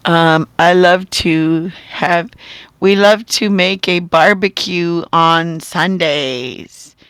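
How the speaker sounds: slow, loud, word by word; dictated example sentence. Shown as a demonstration. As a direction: spell it out slowly for someone writing along. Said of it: "give you a sentence".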